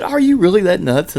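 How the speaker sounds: high, loud mock disbelief; incredulous outburst. Coworker questioning the guard's sanity, loudly incredulous. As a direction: imitate her exclaiming in disbelief.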